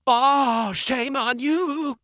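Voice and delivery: high, drawn-out mock scolding; playful, high-pitched shame-on-you wail; mock shaming. Mock scolding over missing ending.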